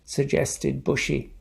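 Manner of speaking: lively storybook voice with character voices; reading to children. A narrator's reading. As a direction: read it like bedtime story time for kids. Reads picture book aloud.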